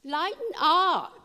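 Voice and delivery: high, slow, drawn-out "Lighten up"; booming playful angel coaxing them to relax; angel's voice. Retold or imitated. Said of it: "He's saying".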